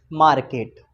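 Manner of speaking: मार्केट said slowly and clearly as "maarket"; clear demo pronunciation. Shown as a demonstration. Teacher showing students how to read the word, clear demo. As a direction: pronounce मार्केट carefully for the class. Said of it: "ये है, क्या है?".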